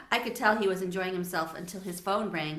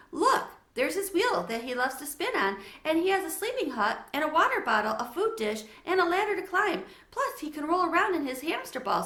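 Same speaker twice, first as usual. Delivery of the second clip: high, eager rush of words; excited exclaiming; 8 semitones higher, 4 dB louder than usual. A narrator's reading. Girl shows friend her hamster, high and eager. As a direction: show off the hamster, bursting with excitement. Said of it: "Sophie exclaimed".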